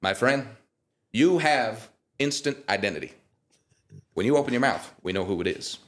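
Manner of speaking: lightly voiced, warm praise from a star judge. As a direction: bestow praise like a gracious legend. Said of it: "he'd go".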